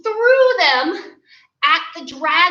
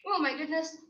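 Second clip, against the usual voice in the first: normal talking voice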